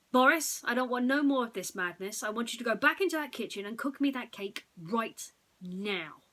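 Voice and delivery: loud, high angry order; angry boss. Acted. Angry boss orders Boris back to bake, loud. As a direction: order him back to the kitchen. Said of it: "This angered Mitchell".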